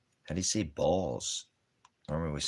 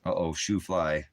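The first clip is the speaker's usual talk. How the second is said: usual speaking voice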